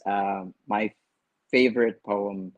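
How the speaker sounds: normal talking voice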